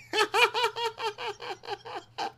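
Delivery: high helpless laugh; burst of laughter. Real, not acted. Commentator cracking up at the insult.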